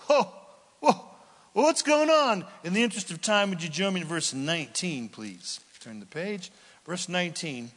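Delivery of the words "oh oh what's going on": high, loud mock panic; mock alarm. Preacher hamming up panic over Israel's disaster. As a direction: cry out in hammed-up alarm.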